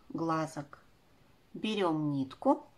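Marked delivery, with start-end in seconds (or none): none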